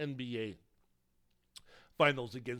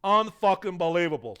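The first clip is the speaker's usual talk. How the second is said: loud, slowed-down mock Boston accent; bad Boston accent; 2 semitones higher, 12 dB louder than usual. Bostonians raving about Marcus Smart, bad Boston accent. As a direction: belt it out like a bad impression of a Bostonian. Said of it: "that's my bad, uh, Boston accent".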